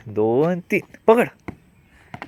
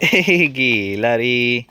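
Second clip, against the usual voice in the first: normal talking voice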